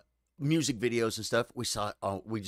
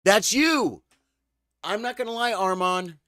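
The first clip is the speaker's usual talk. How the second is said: surprised; 10 semitones higher, 9 dB louder than usual. Real, not acted. Recognizing a sober old friend.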